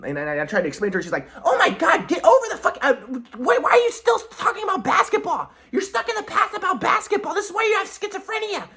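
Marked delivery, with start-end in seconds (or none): 1.4-8.7 very high-pitched hectoring imitation; exasperated mocking mom. Mom telling son to get over basketball, exasperated high mock voice. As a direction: squawk in disbelief, way above your own voice.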